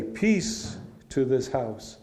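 peace to this house, slow and measured; slow blessing on the doorstep; slow greeting. Shown as a demonstration. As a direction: say "Peace to this house" slowly, dwelling on "peace". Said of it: "first say".

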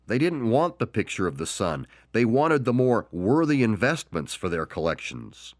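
usual speaking voice

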